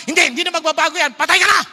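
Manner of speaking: loud, fast doom sentence; hammed-up unforgiving God; mock harsh God. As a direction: snap a final verdict. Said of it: "Hindi siya yung parang".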